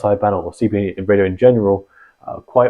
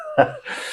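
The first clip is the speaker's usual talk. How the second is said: short laugh; 6 dB quieter than usual. Real, not acted. Laughs at own waffling.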